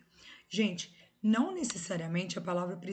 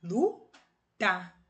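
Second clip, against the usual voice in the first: lutar split in two, lu... tar; "lutar" counted out as two separate beats; syllable by syllable; 4 dB louder than usual. Shown as a demonstration. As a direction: break "lutar" into LU, pause, TAR. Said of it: "duas sílabas".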